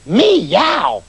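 loud, high yowling meow; a doctor with cat's eyes yowling loudly at a mouse. Acted. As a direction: yowl like a hungry cat. Cat-eyed doctor meows.

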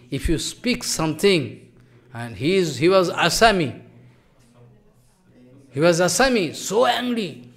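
normal talking voice

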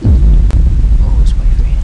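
hushed whisper while hiding. Real, not acted. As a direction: whisper so nothing hears you. Hiding in the game.

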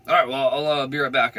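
the officer heading back to his car; cop voice. Retold or imitated.

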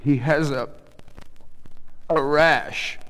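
halting groan with a long pause. A narrator's reading. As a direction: groan out the accusation, faltering. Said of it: "the voice groaned from the bed".

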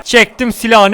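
ordinary delivery

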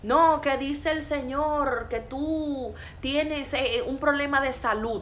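high, drawn-out mock prophecy; mock prophet. Preacher mimicking a prophet addressing someone sick, mock prophet. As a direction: imitate a vague prophet's pronouncement, stretched high.

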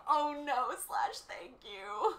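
playfully crushed, then politely thankful; mock devastated. Streamer retelling her embarrassment at the shop.

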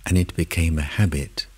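soft, unhurried meditation voice with long pauses; guided meditation. Acted. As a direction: lead them gently inward, phrase by phrase. Leads a guided meditation in first person.

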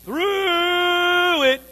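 high, loud, drawn-out through; a weary, groaning call; long drawn-out wail. Shown as a demonstration. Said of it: "But sometimes you're going".

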